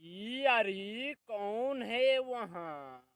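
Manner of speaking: loud, slow, drawn-out calls to someone unseen; shouting mother-in-law. Acted. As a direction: bellow it across the house, demanding an answer. Mother-in-law calling out at home.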